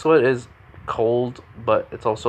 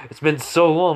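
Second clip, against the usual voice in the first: happy reunion; 6 semitones higher, 4 dB louder than usual. Acted. Old friends hug in reunion.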